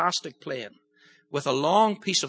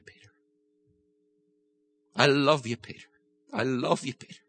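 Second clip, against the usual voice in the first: tender voice of Jesus; 4 semitones lower than usual. Acted. Jesus speaks tenderly to Peter.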